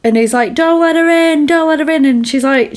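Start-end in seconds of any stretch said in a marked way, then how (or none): 0.5-2.1 high, urgent imitated warning. Captor warning her about the woman, urgently imitated. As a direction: plead in a raised, mock-alarmed voice.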